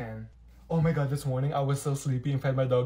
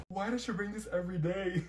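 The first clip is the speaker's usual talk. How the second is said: ordinary delivery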